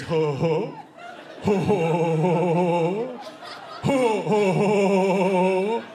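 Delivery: low, hooting ho ho laugh; dopey laughter. Retold or imitated. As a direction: hoot like dumb teenage boys. Boys laughing dopily.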